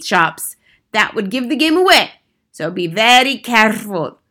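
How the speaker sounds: lightly voiced commanding witch; theatrical witch giving orders; imperious head witch. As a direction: dictate a wicked recipe with emphatic relish. Grand High Witch instructs and warns.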